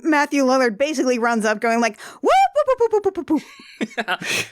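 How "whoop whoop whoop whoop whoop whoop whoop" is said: high excited whoops fading into laughter; whooping. Retold or imitated. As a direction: whoop like a goofy kid. Imitating Lillard running whooping.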